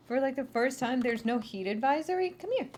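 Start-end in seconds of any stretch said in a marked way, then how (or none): 2.3-2.7 higher coaxing call. Real, not acted. Owner calls Junior, higher. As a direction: coax the dog over. Calling her dog.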